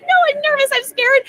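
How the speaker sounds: high, loud panicky squeal; nervous squeal. Retold or imitated. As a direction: squeak it out like a starstruck fan. Nervous fan retells meeting idol.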